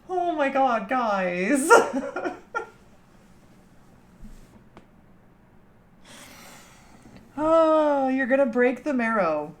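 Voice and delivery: cracking up at what chat just typed; delighted squeal. Real, not acted. Streamer squealing with delight at her chat's joke.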